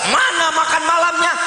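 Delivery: high mock demand for dinner; demanding husband. Husband slamming the table for dinner, high mock demand. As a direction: bark it like a hungry husband.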